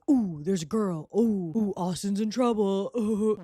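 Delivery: lightly voiced jocks' teasing oohs; teasing football players. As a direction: tease like a locker-room chorus. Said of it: "They're like".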